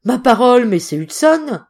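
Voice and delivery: loud, high cry of surprise; startled to recognise an old shipmate; ma parole, a loud, startled shout; surprised cry. A narrator's reading. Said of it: "s'écria monsieur Trevor d'un air surpris".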